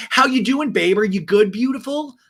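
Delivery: high, loud sing-out greeting; gushing boyfriend. Retold or imitated. Ryan FaceTimes Jen getting glammed, mock gushing greeting.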